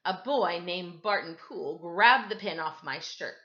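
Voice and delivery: plain reading voice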